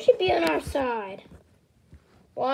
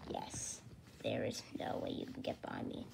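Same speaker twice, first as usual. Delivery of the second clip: low, whispered demonic threat; possessed voice; 18 dB quieter than usual. Acted. Possessed Guru warning the kids off, low whisper. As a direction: breathe it like a possessed ghost. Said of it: "I think Guru is possessed".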